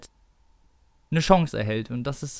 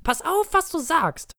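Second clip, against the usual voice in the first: hoch und drohend gefaucht. Acted. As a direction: droh dem Bruder hoch und scharf.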